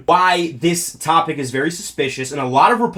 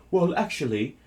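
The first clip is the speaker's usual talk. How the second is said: mocking British accent, softer; a posh-ish British Prince Andrew parody; lightly done British accent; 3 dB quieter than usual. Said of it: "I'm gonna do a British accent".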